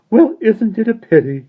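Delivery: high, loud but sober sigh of regret; soberly. A narrator's reading. Mister Wig to the children, sober. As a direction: let the joy drain away. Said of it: "he said soberly".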